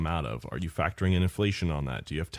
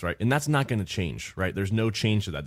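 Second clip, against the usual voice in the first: ordinary delivery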